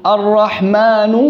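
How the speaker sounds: "الرحمن" chanted with final u, "ar-rahmanu"; wrong vowel demo. Shown as a demonstration. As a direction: slowly end "الرحمن" on a damma. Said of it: "لا،".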